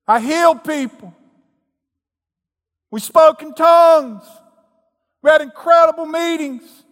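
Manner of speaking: high, loud desperate plea; shrill boasting plea at the judgment; pleading false preacher. Acted. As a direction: cry out to Jesus like a frantic preacher.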